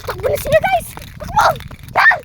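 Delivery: high, loud urgent shout. Real, not acted. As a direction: yell at them to run. Urging friends to run.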